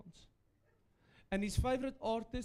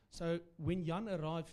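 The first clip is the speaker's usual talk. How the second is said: ordinary delivery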